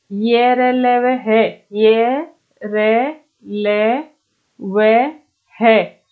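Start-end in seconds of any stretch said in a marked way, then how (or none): 1.7-6.0 each letter drawn out with a pause after; slow letter-by-letter recital. Shown as a demonstration. Teacher dictating letters to students, slowly, one by one. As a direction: stretch out ये, र, ल, व, है one at a time.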